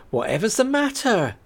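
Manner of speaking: a hint of a worried milkwoman; lightly voiced driver. Driver asks the frantic stranger what's wrong, concerned.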